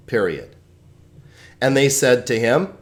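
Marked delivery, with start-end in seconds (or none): none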